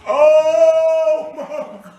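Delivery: loud, high shouted yo's of disbelief; stunned shout. Acted. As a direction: yell out in shock.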